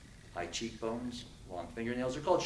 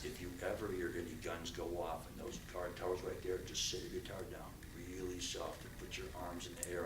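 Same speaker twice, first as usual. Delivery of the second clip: much softer, low murmured guard warning; low, soft warning from the escorting officer; hushed guard warning; 9 semitones lower, 16 dB quieter than usual. Retold or imitated. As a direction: drop way down as if a guard speaks it under his breath. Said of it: "And the guy says".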